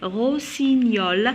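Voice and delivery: very slow "Rossignol", gn gliding as ny; drawn-out model of "Rossignol", no hard g. Shown as a demonstration. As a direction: stretch "Rossignol" into ro-si-nyol. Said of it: "je le prononce comme Y".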